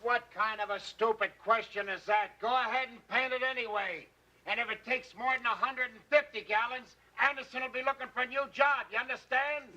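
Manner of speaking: high-pitched, hectoring rebuke; angry boss. Acted. Boss rebukes staff over paint costs, angry and hectoring. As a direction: lay down the law, pressing on fast to the threat.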